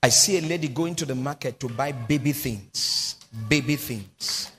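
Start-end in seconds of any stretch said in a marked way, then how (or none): 3.5-4.5 slow, low repeated words; prophetic murmur. Real, not acted. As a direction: linger on the vision, drawn out. Prophetic vision, repeated slowly.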